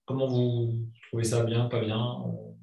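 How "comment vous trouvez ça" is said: ordinary delivery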